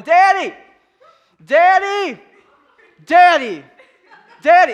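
put-on very loud, high-pitched child's yell; piercing mock kid holler; little kid calling Daddy. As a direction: imitate a kid shouting for attention. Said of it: "this little kid going".

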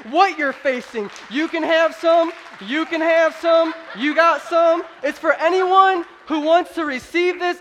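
high, loud, rousing sermon delivery; preaching at full force. Real, not acted. Preacher telling the congregation anyone can partake, preaching at full force. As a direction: preach it out to the whole room.

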